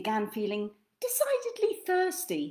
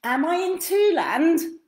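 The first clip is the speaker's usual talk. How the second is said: bewildered; 7 semitones higher, 6 dB louder, slower than usual. Acted. Puzzled guest asks.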